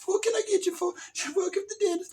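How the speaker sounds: silly high-pitched waitress; cat woman. Acted. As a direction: greet diners as a batty cat waitress. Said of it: "She's a cat woman".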